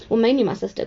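ordinary talk

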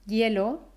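"hielo" dicho "yelo", tono ascendente; H como Y. Shown as a demonstration. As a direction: empieza "hielo" con sonido ye. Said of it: "Cuando pronuncio la H en la palabra". ("hielo" como "yelo")

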